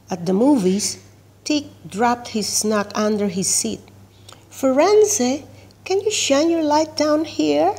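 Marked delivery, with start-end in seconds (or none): 4.5-7.7 high, raised call to a friend above; a lightly voiced kid calling out for help. A narrator's reading. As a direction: call up to her from down under the seat. Tick calls up from under seat.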